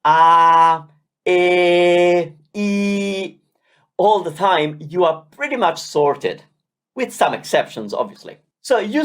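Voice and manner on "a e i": a, e, i held long and open; clear open vowels. Shown as a demonstration. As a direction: stretch each vowel wide and pure. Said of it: "keep those vowel sounds clear and open".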